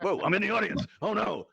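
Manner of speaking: high-pitched pretend dismay; mock alarm. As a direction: play-act a startled "oh no". Playing at being thrown into audience.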